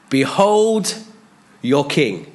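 high, loud proclamation of the king; Pilate proclaiming. Retold or imitated. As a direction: announce it to the crowd with a sudden high cry.

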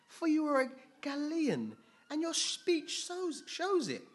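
high-pitched, insistent accusation; accusing bystanders. Acted. Bystanders telling Peter his speech betrays him, high accusing voice. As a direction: press the charge in a high, loud voice.

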